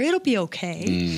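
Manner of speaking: slow, soothing reassurance; calm her down slowly; telling the doctor it will be okay. Retold or imitated. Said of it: "I'm telling my doctor".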